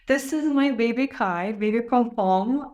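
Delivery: normal talking voice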